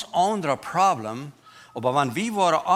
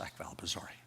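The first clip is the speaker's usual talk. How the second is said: whispered quote; 9 semitones lower, 17 dB quieter, much faster than usual. Retold or imitated. Voicing women's eager reply.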